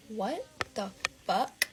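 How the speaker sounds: slow, word-by-word shock; retold stunned disbelief. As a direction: draw out each word in quiet disbelief.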